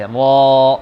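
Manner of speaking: را said loud with a full mouth; heavy, full-mouthed را. Shown as a demonstration. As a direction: fill the mouth for را. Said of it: "منہ بھر کے پڑھتے ہیں اسے".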